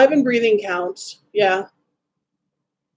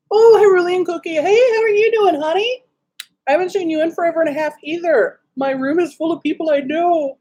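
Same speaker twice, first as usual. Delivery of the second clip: bright high welcome, a little quicker at the end; excited greeting; 9 semitones higher than usual. Real, not acted. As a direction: gush over someone you missed. Greeting a friend in chat.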